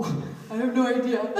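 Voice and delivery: loud laugh breaking into the words. Retold or imitated. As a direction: laugh through the mock cluelessness. Laughs at her own blanking.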